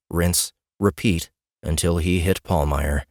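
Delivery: usual speaking voice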